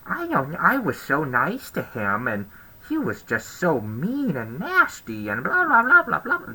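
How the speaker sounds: a mocking whine that squeaks high into blah blah; mock whiny Karen. Mocking the gym Karen's complaints to the audience in a whiny imitation. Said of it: "she just would not shut up".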